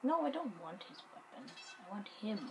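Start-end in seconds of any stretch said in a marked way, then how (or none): none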